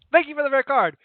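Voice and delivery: loud, high sarcastic Italian thanks; Italian accent. Acted. Wife retorting to her husband, sarcastic loud Italian accent. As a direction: toss it back with a mock sweet bite. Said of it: "Italian couple dispute".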